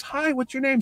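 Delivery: put-on high, sing-song greeting to a small boy; bright high voice for a shy kid; gently coaxing a child; kindly voice to a child. Mark Hamill greeting small child.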